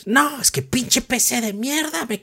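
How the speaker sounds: high-pitched mock rant, slow at first; Greg Miller rant. Imitating Greg Miller cursing his PC on Twitter, high mock fury. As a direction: ham up a furious gamer's complaint about his broken PC. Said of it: "empezó a hacer un rant puerco".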